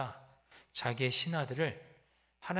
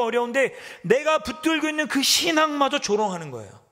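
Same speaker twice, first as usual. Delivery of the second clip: loud, much higher preaching cry; impassioned preaching; 10 semitones higher, 9 dB louder than usual. Real, not acted. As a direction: pour out the anguish to the congregation. Preacher at full force.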